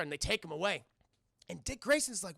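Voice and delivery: ordinary delivery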